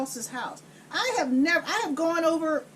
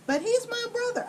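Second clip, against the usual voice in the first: pleading whine; 9 semitones higher than usual. Retold or imitated. Connie pleading.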